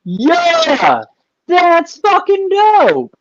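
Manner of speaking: high, drawn-out excited approval; excited reaction. Real, not acted. Host cheering a friend's celebrity story, excited. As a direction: stretch it out high, thrilled for him.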